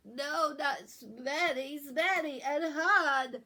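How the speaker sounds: loud, high and slow through a blocked nose; insist, nose all clogged; stuffed-up protest. Acted. Boy correcting his sister, loud slow stuffed-up protest. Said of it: "in his not very right stuffed up voice".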